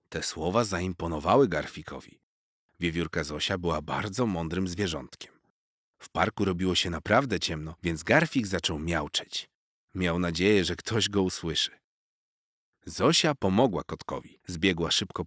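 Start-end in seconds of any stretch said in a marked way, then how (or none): none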